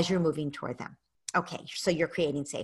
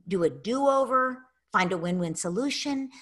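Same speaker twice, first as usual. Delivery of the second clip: usual speaking voice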